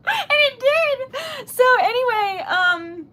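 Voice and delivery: crying out the words, slowly. Real, not acted. Telling viewers the kind wish came true, tearful and high.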